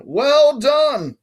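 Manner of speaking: put-on high, loud British-accented congratulations; patronizing British praise. A narrator's reading. Guard praises boy for saying his name, patronizing British accent.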